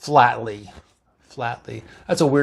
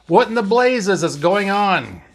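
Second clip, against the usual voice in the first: higher-pitched, exasperated demand; alarmed yell; 5 semitones higher than usual. A narrator's reading. Doctor demanding to know what happened, alarmed yell. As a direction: demand an explanation, mildly raised voice. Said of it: "McCoy yelled".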